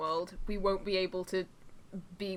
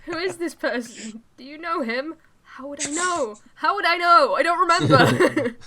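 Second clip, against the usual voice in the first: higher, rising into a loud panicked laugh; flustered character voice; 8 semitones higher, 6 dB louder, slower than usual. Acted. Reading a visual novel boy, panic building to loud. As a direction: play the confused boy losing it.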